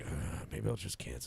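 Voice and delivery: slow, soft grumble to himself; reluctant grumble. Retold or imitated. Recalling his thought about the long drive, reluctant grumble. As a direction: act out weary reluctance, very softly.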